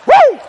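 sharp high loud whoop. Real, not acted. Preacher whooping about the cold, high loud whoop.

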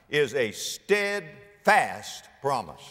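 steadfast stretched out slowly, syllable by syllable; stress on STEADFAST. Real, not acted. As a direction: lean hard and slow on "steadfast". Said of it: "Emphasis on the word steadfast".

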